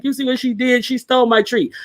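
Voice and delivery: high-pitched imitation of a complaining dog; tattling dog. Dog complaining to owner about stolen treat, high mock tattling. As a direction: voice the pet telling on the other one. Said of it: "he'll come look at me like".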